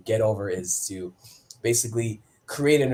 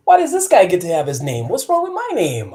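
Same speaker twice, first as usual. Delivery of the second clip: high-pitched mock outrage; indignant protest; 8 semitones higher, 8 dB louder than usual. Young wrestler protesting the name his agent picked, indignant. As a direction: whine it up high, as if wronged.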